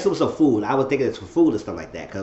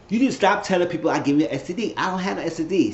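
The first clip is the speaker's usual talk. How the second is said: higher, fast mock scolding; retold confrontation; 7 semitones higher, faster than usual. Zach confronting Danny about the rumor, indignant retold complaint. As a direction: act out an indignant telling-off.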